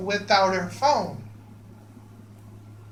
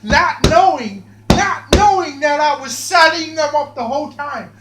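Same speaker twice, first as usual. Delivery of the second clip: louder and higher, gloating over the trap; gleeful build-up; 7 semitones higher, 8 dB louder than usual. Real, not acted. Narrating the trap he set, rising with glee. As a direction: savour the reveal like a villain.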